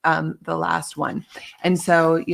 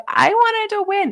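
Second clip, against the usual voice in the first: mock whiny; 5 dB louder, slower than usual. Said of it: "if you were like".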